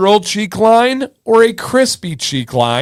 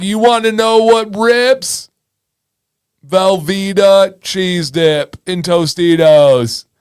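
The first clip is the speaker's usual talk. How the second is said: put-on TikTok dude's accent; slower than usual. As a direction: stretch it out in a butchered dude accent. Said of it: "his accent".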